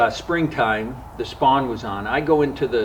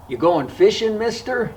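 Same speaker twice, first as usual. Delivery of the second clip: put-on higher, childlike voice asking the question; little boy voice; 10 semitones higher than usual. Little boy at the dock asks the narrator, childlike voice. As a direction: play the seven-year-old boy on the dock.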